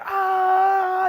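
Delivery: long, very high "aaah"; playful scream. Retold or imitated. Everyone in the car playing hurricane, long high mock scream.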